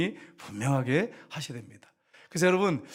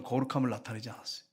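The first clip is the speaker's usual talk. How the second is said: ordinary talk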